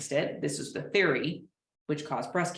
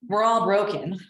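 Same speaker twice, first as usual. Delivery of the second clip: louder, breezy dismissal, punching all; brushing it off; 4 semitones higher, 7 dB louder than usual. Retold or imitated. Client shrugging off a colleague's concern, loud breezy brush-off. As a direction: wave it away lightly. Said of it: "They brush it off".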